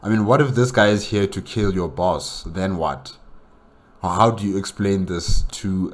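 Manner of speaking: ordinary delivery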